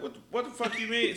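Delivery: high, startled what-the-fuck; shocked outburst. Retold or imitated. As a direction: blurt it out high and loud in disbelief. Retold shocked reaction.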